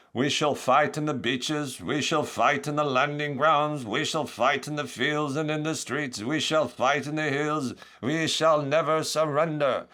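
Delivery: slow, high-pitched wartime oratory; Winston Churchill. Acted. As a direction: proclaim it steadily, every phrase drawn out. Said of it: "Then Winston Churchill".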